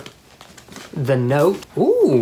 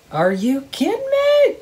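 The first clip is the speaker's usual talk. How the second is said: thrilled disbelief. Real, not acted. Finding red wine in the box, delighted disbelief. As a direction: shriek your disbelief happily. Surprise at gift.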